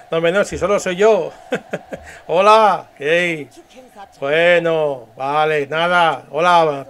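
jovial leader hailing the crowd; drawn-out greetings over applause; dubbed leader. Acted. Leader greeting the clapping people, lightly voiced jovial public address. Voy a hacer otra vez el doblaje.

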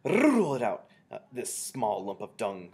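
high, loud burst with long rolled R; screeched. A narrator's reading. Grand High Witch ordering the hidden one found, screeched. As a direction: screech the order, then ease on the insult. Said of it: "screeched the Grand High Witch".

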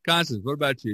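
ordinary talk

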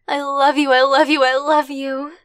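a gushing declaration to a partner on the phone; joyful repeat. Acted. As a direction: gush it out three times.

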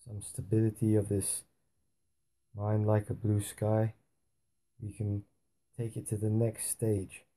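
soft, unhurried meditation voice with long pauses; guided meditation. Acted. Teacher leading listeners into a blue-sky meditation, softly. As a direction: guide them gently into stillness, hushed and spacious.

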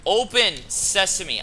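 loud, slow, high call; playful magic-word call, drawn out; mock incantation. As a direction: command the door, stretched out. Calls a magic phrase at door.

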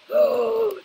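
startled sharp gasp. Real, not acted. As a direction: gasp in shock. Startled by game.

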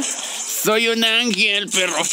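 drawn-out, slow, mock-heavenly line; dramatic catchphrase. Streamer to his viewers, slow mock-dramatic boast. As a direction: stretch it out like a proud angel.

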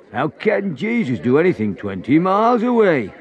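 loud, sneering laugh. Acted. Man in the crowd sneering at Jesus, laughing. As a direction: scoff and laugh him down.

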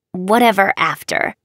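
ordinary delivery

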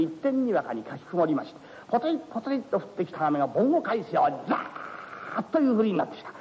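low, relaxed rakugo narration; low, easy narrator aside; storyteller narration. A narrator's reading. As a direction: chat to the audience as storyteller.

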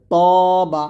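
তবা with heavy, loud t and long rounded vowel; thick to. Shown as a demonstration. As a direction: make the opening of তবা fat and drawn out. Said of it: "ত মোটা করতে হবে".

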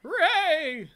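high, playful cheer; cheerful hooray. Real, not acted. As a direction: toss out a bright cheer. Mock cheer.

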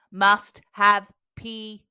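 loud, slow robot chant; robotic giant voice. A narrator's reading. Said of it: "it said".

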